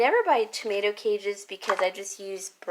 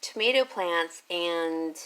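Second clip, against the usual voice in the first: normal talking voice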